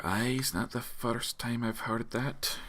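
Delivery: slow, soft, bard muttering. Acted. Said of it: "you hear him mutter".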